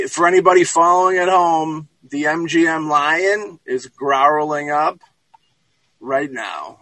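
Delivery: usual speaking voice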